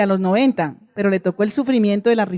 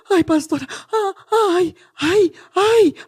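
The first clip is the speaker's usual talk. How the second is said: rising wailing ays, higher and higher; lamenting moan; 7 semitones higher, slower than usual. Retold or imitated. Sick man moaning to the pastor, rising wail.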